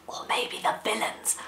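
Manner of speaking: soft stage whisper; conspiratorial whisper. Pretend. Host hints at villains in the family, conspiratorially whispering. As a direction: drop to a secretive whisper. Playful secret aside to children.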